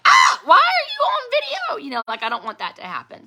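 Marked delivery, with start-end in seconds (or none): 0.1-1.8 high, loud startled yelp; shocked cry of someone caught on the toilet; startled shout. Pretend. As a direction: jump out of your skin on the toilet. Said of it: "if they're like".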